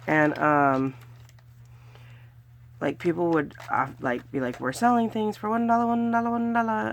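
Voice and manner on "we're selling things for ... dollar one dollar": high, loud, quick hawker chant; rapid-fire market cry held on a high note; street vendor's call. Retold or imitated. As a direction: imitate a seller calling out one-dollar bargains. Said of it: "that's how they say it".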